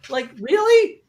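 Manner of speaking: high, loud, slow mock fury; mock outrage. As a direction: yell at friends in joking betrayal.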